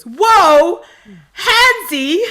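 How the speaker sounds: loud, high mock exclamation; loudest Jordan voice. Fan retelling Jordan's reaction to being touched, hammed-up loud shout. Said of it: "in the loudest Jordan voice I've ever heard off stage".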